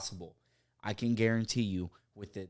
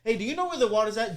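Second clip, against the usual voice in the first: much higher customer voice; imitated customer; 10 semitones higher, 4 dB louder, faster than usual. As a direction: play the casual regular. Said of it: "customer quoted".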